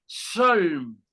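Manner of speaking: Shalm as one drawn-out syllable; basic pronunciation demo. Shown as a demonstration. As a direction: Shalm slowly, vowel-poor, ending on held consonants. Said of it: "en la pronunciación básica sería".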